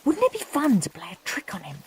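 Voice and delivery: fast, soft sly whisper; scheming whisper. A narrator's reading. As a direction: plot the trick in a hush. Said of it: "thought Henry".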